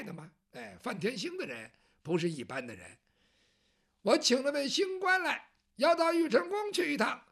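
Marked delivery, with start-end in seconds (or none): 4.0-7.3 eunuch announcing. Acted.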